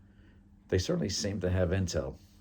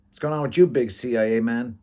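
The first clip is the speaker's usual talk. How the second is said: high, loud hello; acted rowdy bar greeting; boisterous teasing greeting; 6 semitones higher, 9 dB louder than usual. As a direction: hail your big-shot buddy loudly. Friend teases arriving CIA man.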